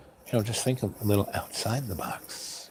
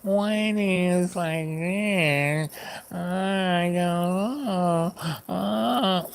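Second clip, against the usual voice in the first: drawn-out high-pitched whine; mock a whiner, stretching every word high; whining; 10 semitones higher, much slower than usual. Shown as a demonstration. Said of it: "Do I really whine?".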